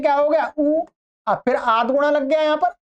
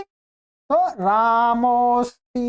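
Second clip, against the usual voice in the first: drawn out slowly; much slower than usual. Shown as a demonstration. Teacher spells out sandhi result.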